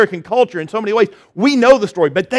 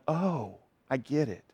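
quiet realization; 5 semitones lower, 14 dB quieter than usual. Retold or imitated. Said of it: "it clicked and you're going".

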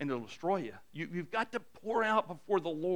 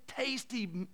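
ordinary talk

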